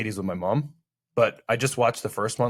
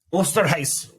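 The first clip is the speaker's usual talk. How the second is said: put-on Osterreis in a German accent, raised pitch, "OH-stah-rice"; 7 semitones higher, slower than usual. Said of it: "You gotta say it with a German accent".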